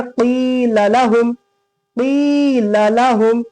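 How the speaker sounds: "क़ील लहुम" with a long held "क़ी"; long vowel recitation. Shown as a demonstration. Teacher showing where to stretch, long vowel recitation. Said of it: "क़ी को खींचेंगे".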